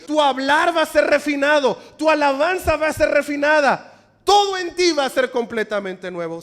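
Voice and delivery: loud fervent proclamation; preaching at full force. Acted. Preacher promises the church renewal, loud fervent preaching.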